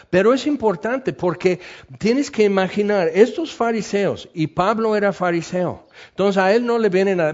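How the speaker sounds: usual speaking voice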